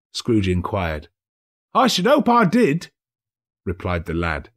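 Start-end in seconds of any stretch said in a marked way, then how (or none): 1.7-2.9 put-on high, loud boy's reply; boy calling back up; cheeky lad; street boy. Boy voiced.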